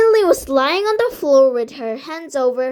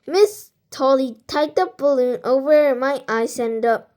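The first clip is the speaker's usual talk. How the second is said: usual speaking voice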